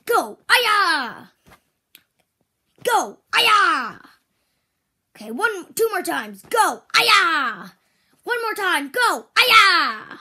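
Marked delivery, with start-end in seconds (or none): 0.5-1.3 loud high kiai; karate shout. Shown as a demonstration. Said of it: "you say "Aya!". 3.3-4.0 loud high kiai; karate shout. Shown as a demonstration. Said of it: "you say "Aya!". 6.9-7.7 loud high kiai; karate shout. Shown as a demonstration. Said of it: "you say "Aya!". 9.4-10.2 loud high kiai; karate shout. Shown as a demonstration. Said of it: "you say "Aya!".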